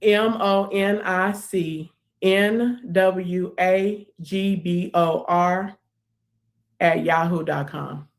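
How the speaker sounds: each letter slow and spaced; slow spelling. Real, not acted. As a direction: spell it out slowly. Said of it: "repeated spelling".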